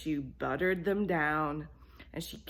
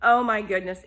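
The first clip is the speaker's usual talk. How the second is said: loud amazed oh my goodness; a touch of awe; 4 semitones higher, 10 dB louder than usual. Acted. Cat smells the bread, loud.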